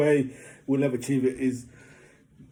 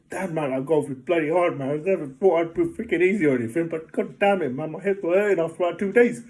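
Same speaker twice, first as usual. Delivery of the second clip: rising pitch, louder and louder; exasperated golf rant, getting louder; worked up; 4 semitones higher, 5 dB louder than usual. Real, not acted. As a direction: vent it, getting more wound up. Venting about hard golf.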